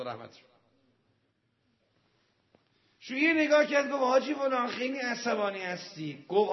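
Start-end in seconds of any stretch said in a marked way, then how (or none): 3.0-6.2 animated retelling. Retold or imitated. Cleric teases angry bazaari.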